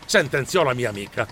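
ordinary delivery, nothing marked

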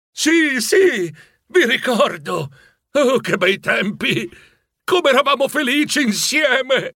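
high, drawn-out joyful cries; joyful cry. A narrator's reading. Scrooge longing to greet old friends, joyful cry. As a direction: cry out with overflowing nostalgia.

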